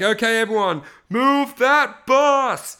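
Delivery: loud, high TV reveal call, stretched out; makeover show host. Retold or imitated. Host calling to the crowd for the house reveal, drawn-out shout. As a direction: imitate a host's slow, rising big reveal shout. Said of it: "it's the guy from Extreme Makeover".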